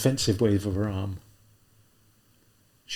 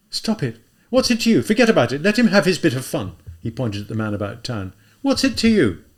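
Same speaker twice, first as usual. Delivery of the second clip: agitated shouting; 5 semitones higher, 6 dB louder than usual. Acted. Raskolnikov shouts at policeman.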